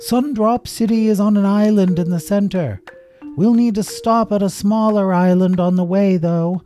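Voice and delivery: high, loud sustained calling; projected flyer's call to passengers. A narrator's reading. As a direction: shout directions over rushing wind and waves. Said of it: "he had to raise his voice".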